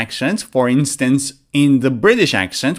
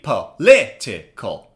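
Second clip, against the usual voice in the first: "Political" slowly split, LIT stressed; low, slow "Political" with pauses, LIT strongest; slow, syllable by syllable; 7 semitones lower, slower than usual. Shown as a demonstration. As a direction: model "Political" in four separate beats for repeating. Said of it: "Now say it faster".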